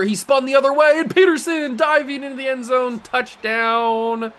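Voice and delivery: high, loud broadcaster scream as he dives in; excited commentator yell. Pretend. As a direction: go wild like a TV announcer on the score. Excited call of his touchdown.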